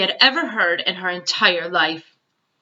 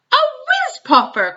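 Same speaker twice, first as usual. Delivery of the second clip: much higher, delighted giant shout; beaming cry; 4 dB louder than usual. A narrator's reading. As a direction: burst out with glee, voice leaping high. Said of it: "cried the B F G, beaming".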